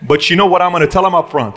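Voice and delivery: ordinary talk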